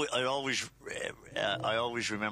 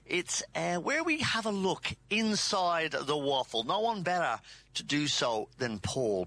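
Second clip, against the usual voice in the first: bright, raised Irish-tinged radio host opening the segment; radio show opener; 8 semitones higher than usual. Acted. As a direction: launch the show with upbeat announcer energy.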